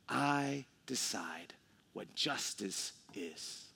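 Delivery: whispered declaration. Acted. Landowner voiced, unvoiced whisper.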